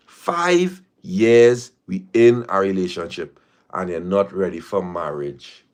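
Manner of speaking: "Five years" drawn out word by word; slow it right down so every word sinks in. Shown as a demonstration. Repeating it slowly so the listener hears how it sounds, slow and deliberate. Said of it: "let me say it slower".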